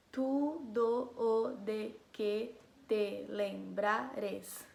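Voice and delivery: each syllable drawn out separately, "do" and "o" kept apart; syllable-by-syllable count. Shown as a demonstration. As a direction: count out "tu, do, o, de, que, te, lembrares" slowly, every syllable alone. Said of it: "Eu sei contar as sílabas".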